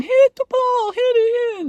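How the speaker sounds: put-on high shrill yelling at a kid; mocking kids' screams. Kids screaming at the clumsy girl on court. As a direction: scream it like angry teammates. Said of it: "they were all screaming at me".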